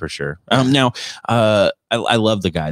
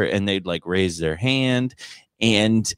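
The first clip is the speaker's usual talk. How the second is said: usual speaking voice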